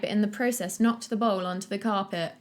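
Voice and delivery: usual speaking voice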